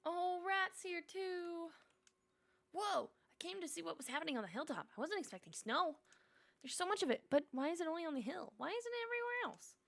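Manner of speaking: high, squeaky little animal; squeaky wondering critter; small rat voice. Acted. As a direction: chatter like a curious rat. Rat character talks.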